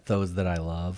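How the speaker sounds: low, slow, hushed creepy killer; serial killer voice. Retold or imitated. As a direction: mutter it like a lurking murderer. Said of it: "He sounds like a serial killer".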